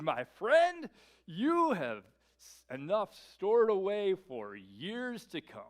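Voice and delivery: pat yourself on the back slowly; the rich man telling himself he has enough, smug. Acted.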